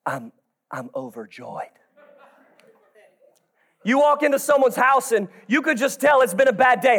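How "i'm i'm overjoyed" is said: low, quiet and joyless, a laugh slipping in; deadpan mumble. Retold or imitated. Churchgoer repeating overjoyed in a low, joyless mumble. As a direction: mumble it again with no feeling. Repeats it flatly when asked again.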